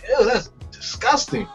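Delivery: normal talking voice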